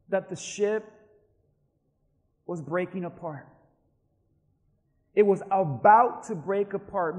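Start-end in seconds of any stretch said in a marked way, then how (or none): 5.1-7.2 "about" punched out loud; stress on about. Real, not acted. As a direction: lean hard on "about". Stresses that it nearly happened.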